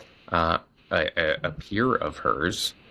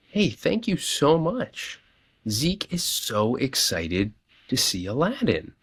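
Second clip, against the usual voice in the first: put-on slow, high, sugary thank-you to a child; overly sweet thanks; 5 semitones higher, slower than usual. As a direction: lay it on sweetly for the kid. Thanking the boy warmly.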